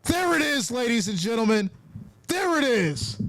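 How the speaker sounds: high-pitched, elated crowing; real exultant sports-fan glee; triumphant celebration. Real, not acted. Celebrating his called touchdown play.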